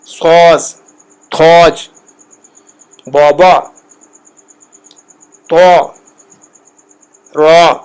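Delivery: بابا with rounded "aw" vowels, like "bawbaw"; سوز and تھوج as firm, isolated words with "aw"; Persian long vowel. Shown as a demonstration. Teacher listing further examples, Persian long vowel. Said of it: "اس طرح کر کے الفاظ".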